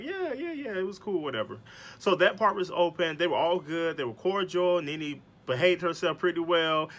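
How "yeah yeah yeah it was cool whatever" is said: high breezy yeah, trailing down into a shrug; dismissive yeah. Retold or imitated. Cynthia shrugging off Noelle's question, high breezy dismissal. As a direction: brush it off lightly, high then dropping. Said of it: "Cynthia was like".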